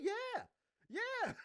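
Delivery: very high, loud, cheeky yes; mock confident yell. Goku answering offended Glorio, mock confident yell. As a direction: imitate a blunt, cocky yell.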